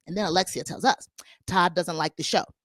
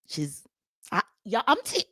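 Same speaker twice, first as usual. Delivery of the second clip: ordinary talk, nothing marked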